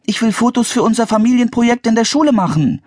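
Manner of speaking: put-on high-pitched, insistent girl's voice; Anne, insistent child. A narrator's reading. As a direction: make her demand in a high voice. (hohe, fordernde Mädchenstimme)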